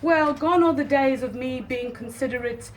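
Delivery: normal talking voice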